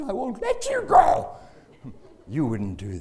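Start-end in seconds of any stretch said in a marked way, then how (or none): 0.0-1.4 a high, loud and slow vow; defiant cry. Retold or imitated. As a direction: plant yourself in the doorway and refuse. Father blocking the door.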